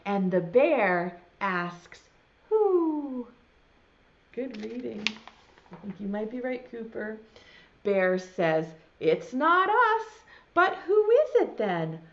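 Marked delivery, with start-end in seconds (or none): 2.5-3.3 Bear's voice. Acted. Bear asks again. 9.0-12.0 Bear's voice. Acted. Bear speaks in the story.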